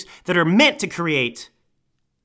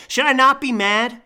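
ordinary talk

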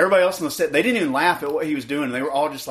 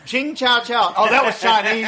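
high, laughing mimicry of his slip; mock him through laughter; mimicking cohost; 8 semitones higher, 3 dB louder than usual. Cohost mimics his friend's bad accent, laughing. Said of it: "you going".

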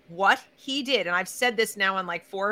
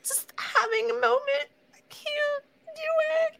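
high, squeaky fake crying; hammed-up weepy student voice; mock tearful. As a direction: whimper it out as if falling apart, badly faked. Acts a distressed student complaining.

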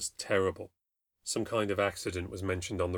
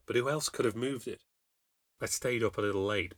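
plain reading voice

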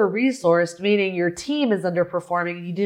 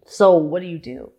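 normal talking voice

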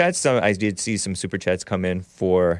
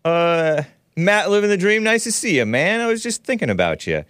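much higher, louder, eager welcome; excited greeting; 9 semitones higher, 3 dB louder than usual. Real, not acted. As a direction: greet the guest with a big lift. Host greeting a guest.

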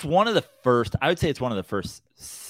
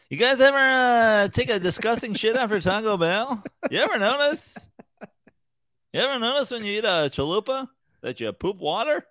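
Seinfeld impression; 11 semitones higher than usual. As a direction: whine like a nineties observational comic. Parody of Seinfeld observational bit.

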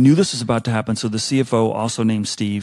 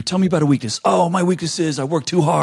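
sing-song mock interviewee, pitched up; mocking cliché answers; 6 semitones higher than usual. To job candidates, mocking their canned interview answers. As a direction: parody a rehearsed candidate's canned lines. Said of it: "all these blah, blah".

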